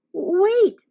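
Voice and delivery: put-on a high, loud kid's cry; boy shouts wait at the monster; urgent shout. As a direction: cry out to stop them.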